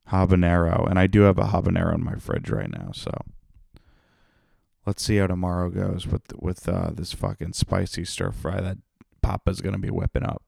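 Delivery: usual speaking voice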